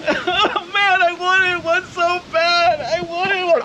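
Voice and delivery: put-on high whiny child, slow; a sulky son who wanted one; whiny kid. Plays disappointed child viewer.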